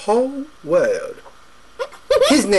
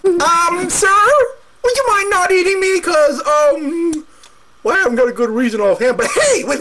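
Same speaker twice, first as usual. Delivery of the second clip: nervous goat; 11 semitones higher than usual. Acted. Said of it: "What you looking at?".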